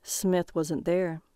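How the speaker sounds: ordinary delivery, nothing marked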